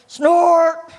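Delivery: big drawn-out storybook "snort"; loud snort noise. Acted. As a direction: boom it out like a mom reading aloud. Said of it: "you get to go".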